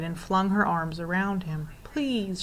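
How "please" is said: higher, small imploring word; begging. A narrator's reading. Sobbing Lindy clings to the Proc, begging. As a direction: beg gently, voice lifted. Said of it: "she begged".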